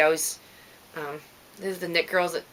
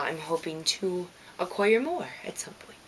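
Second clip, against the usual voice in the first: hushed, breathy aside; whisper; 6 dB quieter than usual. Real, not acted. Knitter whispering her hope for more needles, hushed. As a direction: whisper the wish like a secret.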